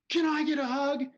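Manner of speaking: high, loud imitated yell; shouted request. Big tattooed man asking the driver, shouting. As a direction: demand affection at a shout. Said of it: "he's still shouting at me".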